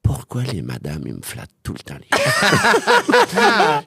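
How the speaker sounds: put-on small child's puzzled question; little boy voice. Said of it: "mon fils a fait".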